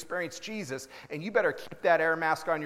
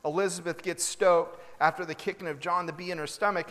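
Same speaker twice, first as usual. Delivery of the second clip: ordinary talk, nothing marked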